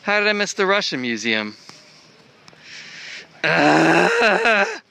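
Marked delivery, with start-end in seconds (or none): 3.4-4.8 loud high exasperated ah; frustrated shout. Real, not acted. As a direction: groan out in frustration. Frustrated outburst.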